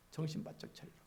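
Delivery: voiceless, hushed repeat; a pastor whispering the warning again; whispered echo. Acted. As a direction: drop to a whisper for the second time.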